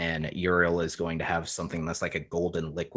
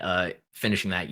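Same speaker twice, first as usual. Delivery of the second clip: ordinary talk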